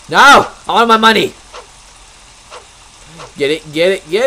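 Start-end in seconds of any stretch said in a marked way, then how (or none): 0.1-1.3 loud greedy yell; shouting. Acted. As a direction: yell for the money. Greedy outburst over money.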